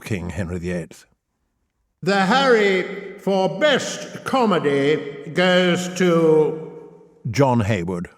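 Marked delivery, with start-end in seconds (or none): 2.0-8.1 grand, slow, drawn-out awards host voice; milk the suspense like an Oscars presenter; awards announcer. Pretend. Host reading out the Best Poet award, in a grand slow announcer voice.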